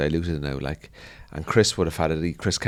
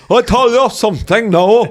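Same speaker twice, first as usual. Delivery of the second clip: very high, loud, slow Cork-Jamaican singsong; exaggerated Cork-meets-Jamaica accent; 10 dB louder than usual. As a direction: play up the Jamaican lilt in Cork speech. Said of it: "the accent became, like, the most prominent accent".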